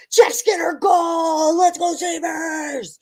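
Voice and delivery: shouting a goal call, drawn out; excited goal shout. Real, not acted. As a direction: cheer the goal at full voice. Celebrating a hockey goal live.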